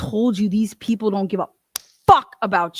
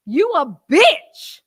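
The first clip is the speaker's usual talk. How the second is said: louder, higher, slowed-down jab; mock yelled insult; 7 semitones higher, 6 dB louder, much slower than usual. Telling off the co-defendant, drawn-out loud insult. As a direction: spit the last words out slowly and loud. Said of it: "that's why I'm like".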